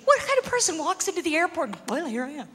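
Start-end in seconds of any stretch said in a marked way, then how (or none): 1.9-2.5 dropped low and much softer, deadpan; mock clueless traveller. Comic mocks a bomber walking in, hushed and low.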